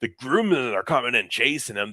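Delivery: ordinary delivery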